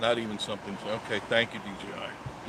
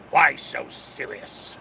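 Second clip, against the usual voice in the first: Joker quote; 4 dB louder, much slower than usual. Retold or imitated. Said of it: "That's from, uh, Batman".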